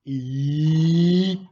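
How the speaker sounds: sudden loud wail of emotion; loud emotional outcry; loud cry. Real, not acted.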